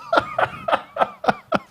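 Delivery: a burst of very high laughter; high giggle. Real, not acted. Telling the audience a funny story, squealing laugh. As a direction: let a high giggle break out.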